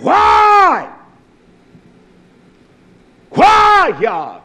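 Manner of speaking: high, loud anguished "Why?"; desperate cry to God. Acted. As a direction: wail up at heaven, demanding an answer. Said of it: "I'm not gonna talk to him that way".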